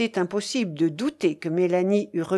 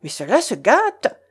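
louder, alarmed exclamation; exclaiming; 3 semitones higher, 5 dB louder than usual. A narrator's reading. Guest sees the wedding going wrong, exclaiming. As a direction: blurt it out with mild alarm. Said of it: "s'écria monsieur Gargarot".

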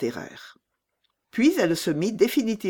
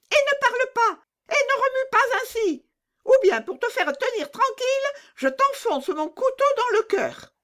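much higher, threatening little bandit; menacing robber girl. Acted. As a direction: scare her into silence.

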